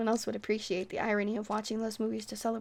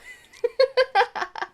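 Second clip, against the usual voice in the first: big villain laugh; 12 semitones higher, 11 dB louder than usual. Pretend. Ends her counting bit.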